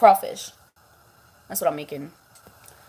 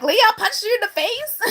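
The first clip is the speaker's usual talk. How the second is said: high shocked viewer voice; shocked question; 13 semitones higher, 4 dB louder than usual. Retold or imitated. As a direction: gasp it out in disbelief. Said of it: "y'all would have been like".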